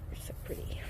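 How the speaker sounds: soft whisper to the horse. Real, not acted. Whispering to her pretty horse. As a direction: breathe it tenderly.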